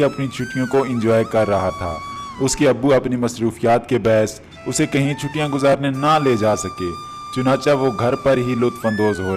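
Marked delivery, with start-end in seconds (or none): none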